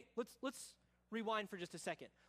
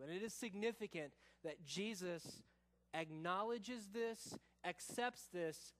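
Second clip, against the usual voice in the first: ordinary talk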